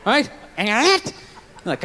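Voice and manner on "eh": "Eh?" with a sharply rising question tune; voice going up. Retold or imitated. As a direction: let "Eh?" slide upward at the end. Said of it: "It goes up".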